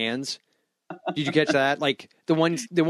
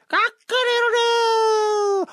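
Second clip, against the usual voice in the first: high, slow shouted rooster crow; 3 dB louder, much slower than usual. Retold or imitated. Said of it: "a silhouette of a dinosaur that goes off on a cliff and goes".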